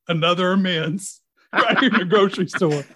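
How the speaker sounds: laughing through the words; real laugh breaking into the line. Real, not acted. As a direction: chuckle at the absurdity as you say it. Retelling awkward moment, laughing.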